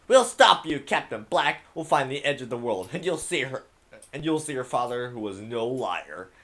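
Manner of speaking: loud, high defiant shout; defiant yell. Acted. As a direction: shout it back at the pirate. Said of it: "The first mate yelled out".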